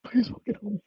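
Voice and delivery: high, pleading cry for help; desperate plea. Acted. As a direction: beg for help in a high strained voice. Desperate plea opening the bit.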